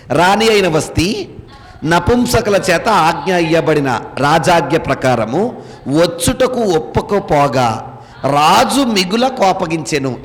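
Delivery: slow, measured reading aloud; scripture reading. Acted. Teacher reads the verse to the class, slow scripture reading. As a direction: read the verse out slowly, phrase by phrase.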